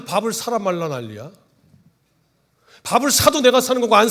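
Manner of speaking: scolding senior. Retold or imitated. Senior pastor scolds the junior for asking, angry and loud. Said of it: "혼을 내시면서".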